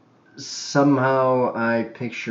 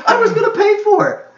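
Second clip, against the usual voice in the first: squeaky high laughing words; giggling, much higher; 8 dB louder than usual. Real, not acted. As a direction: crack up while saying it. Laughing at his own scheme.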